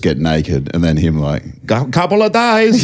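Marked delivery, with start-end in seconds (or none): 1.7-2.8 Thor voice. Retold or imitated.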